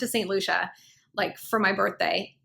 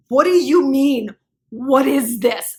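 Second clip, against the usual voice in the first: loud, slow, indignant questions; confronting her boyfriend about the missing words, loud and slow; angry demand; 3 semitones higher, 7 dB louder, slower than usual. Retold or imitated. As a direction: demand it of him loudly. Confronting boyfriend over rose petals.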